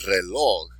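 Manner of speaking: Reloj with "loj" stressed; stress on last syllable. Shown as a demonstration. Teacher modelling words for students, last syllable of Reloj stressed. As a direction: lean on the end of Reloj. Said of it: "accent on the last syllable".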